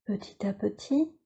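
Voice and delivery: normal talking voice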